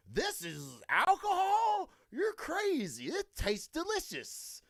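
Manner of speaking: playing his younger self, thrilled by a sweet drink; naive young drinker. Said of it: "at the time I was like".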